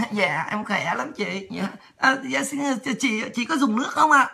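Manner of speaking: soft, hesitant, deferential mimicry; imitating polite deference. Junior officer answering the woman's greeting and offering water, politely meek. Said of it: "Lịch sự lắm".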